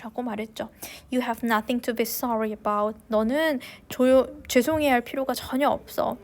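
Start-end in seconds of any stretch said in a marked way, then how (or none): none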